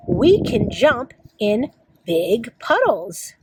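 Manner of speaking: slow, measured picture-book reading; reading to children. Acted. Teacher reads a spring book to kids, slow read-aloud. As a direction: read slowly, each word spaced out for young children.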